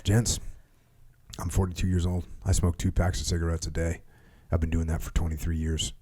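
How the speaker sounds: calm low warrant officer. Retold or imitated. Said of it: "he didn't wanna yell at us".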